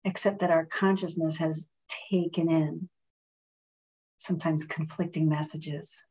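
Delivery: calm, measured meditation voice with long pauses; guided meditation. Real, not acted. Reverend leading friends in a breathing meditation, calm and unhurried.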